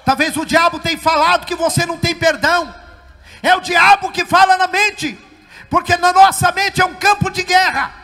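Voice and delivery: high, loud exhortation; full-force preaching. Real, not acted. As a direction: cry out a warning to the congregation.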